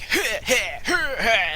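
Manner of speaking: mock fighter's snicker; short huffing heh heh laugh. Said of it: "fight voices".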